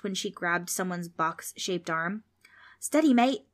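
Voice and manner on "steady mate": higher, louder startled yelp; mildly alarmed little yelp. A narrator's reading. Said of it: "Darby yelped".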